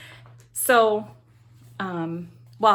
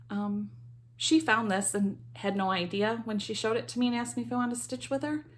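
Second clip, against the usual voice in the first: ordinary talk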